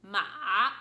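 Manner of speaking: "ma" dipping down then back up; loud, dipping "ma"; third tone. Shown as a demonstration. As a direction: bend "ma" in a V shape. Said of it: "The third Chinese word we practiced".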